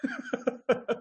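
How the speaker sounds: short falling laugh; a bright burst of real laughter. Real, not acted. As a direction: laugh then settle into the answer. Laughs while answering.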